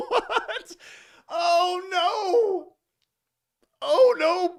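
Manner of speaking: high, helpless laughing through the words; can't stop laughing at the answer; real laughter. Real, not acted. Host laughing at the witness's blunder.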